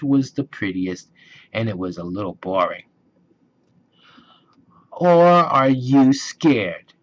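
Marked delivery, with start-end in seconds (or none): 4.9-6.9 teasing. Acted. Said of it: "teased Brother".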